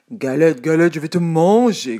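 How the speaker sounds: loud growling bear; big bear voice. A narrator's reading. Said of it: "grogne l'ours de sa grosse voix".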